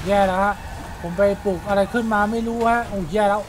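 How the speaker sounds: loud, high alarmed cry of trouble; panicked shout. Real, not acted. Gamer shouting to viewers that he's in trouble, panicked and loud. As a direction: shout it out as the game goes wrong. Monster appears while gaming.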